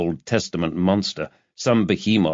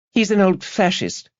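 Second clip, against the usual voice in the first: put-on high young woman's voice, dismissive; scornful young activist; 12 semitones higher than usual. A narrator's reading. Student dismissing the professor, high scornful voice.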